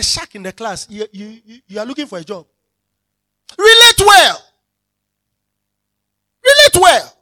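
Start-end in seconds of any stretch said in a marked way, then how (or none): 3.6-4.4 high, loud cry of relate well; emphatic preaching shout. Real, not acted. Preacher crying out to congregation, loud and high. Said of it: "preacher repeats key phrase". 6.4-7.1 high, loud cry of relate well; emphatic preaching shout. Real, not acted. Preacher crying out to congregation, loud and high. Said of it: "preacher repeats key phrase".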